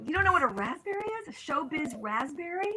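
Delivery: a touch of mock astonishment; incredulous writers. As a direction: play the amazed comedy writer lightly. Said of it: "And they go".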